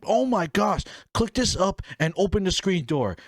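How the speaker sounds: high-pitched frantic urging; mock panic. Sneaking girlfriend out the screen door, acted panic.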